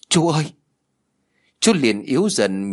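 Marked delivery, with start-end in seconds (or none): 0.1-0.5 higher-pitched call, mildly urgent; crying out. A narrator's reading. Nephew running to his bleeding uncle, crying out. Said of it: "Tôi liền kêu lên".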